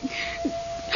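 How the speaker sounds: sharp frightened gasp. Acted. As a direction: catch your breath in fright. Ghostly thing runs through room.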